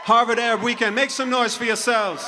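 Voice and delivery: crowd hype shout. Real, not acted. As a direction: pump the crowd up, full voice. Hyping the crowd.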